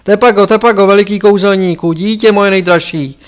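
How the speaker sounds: loud, pressing appeal; urgent calling. Acted. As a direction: call out urgently while rushing to him. Lama rushes down calling his pupil.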